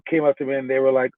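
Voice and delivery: ordinary delivery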